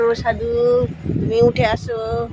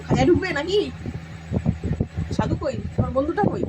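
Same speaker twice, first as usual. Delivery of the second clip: ordinary talk, nothing marked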